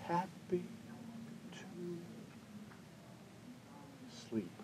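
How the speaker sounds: Mommy softly saying goodnight; sleepy whisper. Acted. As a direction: let it fade into sleep. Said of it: "Mommy said".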